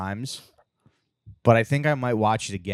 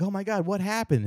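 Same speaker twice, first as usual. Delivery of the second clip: higher-pitched mock-worried question; retold alarm; 11 semitones higher than usual. Sitcom wife arriving at the hospital, mock alarm. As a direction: imitate an alarmed reaction, lightly acted.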